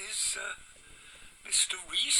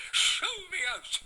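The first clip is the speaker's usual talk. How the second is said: high, loud, slow command; furious show me out; angry order; 9 semitones higher, 5 dB louder, slower than usual. Retold or imitated. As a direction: order the visitor out, indignant. Furious patient ordering nurse.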